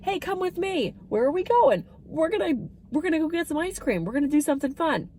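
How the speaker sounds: high, bright, fast cheerful invite; sing-song caregiver excitement; upbeat invitation. Shown as a demonstration. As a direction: sell it like a fun outing. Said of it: "I would say".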